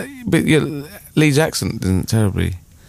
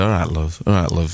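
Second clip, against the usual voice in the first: Sheffield men; 6 semitones lower than usual. Retold or imitated. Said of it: "they all said".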